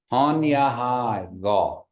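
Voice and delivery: slow, drawn-out Han ya hai ga for repeating; slow model phrase. Shown as a demonstration. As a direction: say it slowly and firmly, each syllable held. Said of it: "we'll practice this a couple of times".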